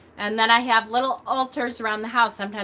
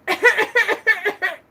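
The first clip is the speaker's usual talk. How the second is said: coughing fit; 11 semitones higher, 5 dB louder than usual. Retold or imitated. Choking on spit at office.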